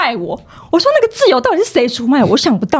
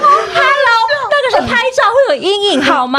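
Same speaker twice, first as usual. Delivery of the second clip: indignant retort; 10 semitones higher than usual. Real, not acted.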